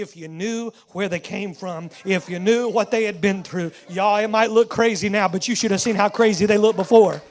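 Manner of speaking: loud, climbing preacher's shout; preaching at full force. Acted. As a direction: drive it home to the congregation. Preacher builds to climax.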